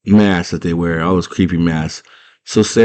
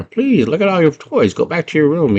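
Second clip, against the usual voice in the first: put-on high-pitched old lady coaxing; put-on high pleading crone; junk lady; 9 semitones higher than usual. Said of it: "the little junk lady was telling her".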